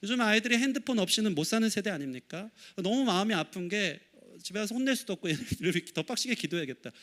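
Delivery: usual speaking voice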